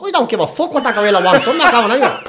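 high, loud indignant refusal; acted fury. Comedian voicing zoo visitor refusing to leave, mock furious. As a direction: imitate a furious man digging in. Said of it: "He was fucking furious".

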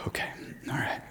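whispered to himself, worn out; dad whispering to steady himself with crying kids; exhausted whisper. Retold or imitated. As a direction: breathe it out, barely voiced. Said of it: "Deep breath".

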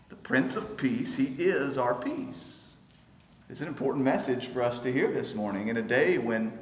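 normal talking voice

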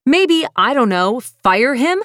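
high, loud mocking shout; sarcastic shout. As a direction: hurl the sarcasm at full volume. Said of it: "I'm shouting now".

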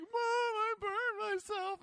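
put-on high tearful child calling mom; kid crying to mom. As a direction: whimper it like a hurt child running to mom. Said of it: "just ran into the house like".

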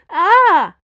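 loud, high startled shriek; startled scream. A narrator's reading. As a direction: shriek in shock at the collision. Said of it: "Dana yelled".

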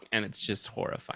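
ordinary talk

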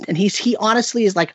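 ordinary talk, nothing marked

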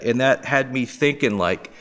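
ordinary talk, nothing marked

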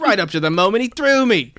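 loud, high, overdone British accent; bad British accent. Shown as a demonstration. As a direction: belt it out in a poor British accent. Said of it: "It's not a good accent".

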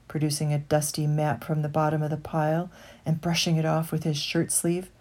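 ordinary delivery, nothing marked

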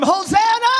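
high, loud cry of praise; screamed praise. Real, not acted. Preacher asking somebody to scream Hosanna, screamed praise. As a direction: scream HOSANNA with a held ending. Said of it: "somebody scream".

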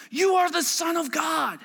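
crying out. Retold or imitated. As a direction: call it out like spirits falling down. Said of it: "they cried out".

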